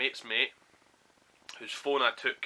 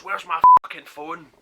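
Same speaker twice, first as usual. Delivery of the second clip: higher, mock-furious demand; retold angry scream; 6 semitones higher, 17 dB louder than usual. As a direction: imitate someone screaming in your face. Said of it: "like, screaming at me".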